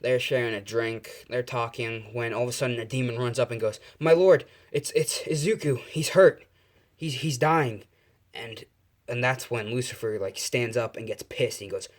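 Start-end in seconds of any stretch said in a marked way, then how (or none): 4.0-7.8 loud, higher, breathless alarm; panicked report. Acted. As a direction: blurt it out in panic to your lord. Demon rushes in with bad news.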